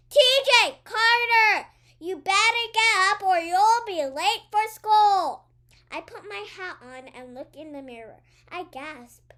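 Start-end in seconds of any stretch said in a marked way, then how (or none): 0.1-5.5 very loud, high angry yell. Acted. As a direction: bellow up the stairs at him. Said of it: "Mama yells again, louder this time".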